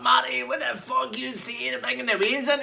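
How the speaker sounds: furious shout. Retold or imitated. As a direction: bellow it in outrage, stretching each word. Gary yelling at barman.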